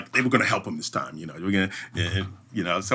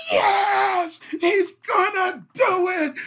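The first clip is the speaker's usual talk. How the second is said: much higher mock crowd shout; crowd cheering; much slower than usual. Crowd following them down cheers, high mock shout. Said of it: "they're like".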